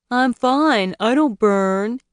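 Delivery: high, whiny imitation of her; mock her in a whiny voice. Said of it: "he mocked in a whiny voice".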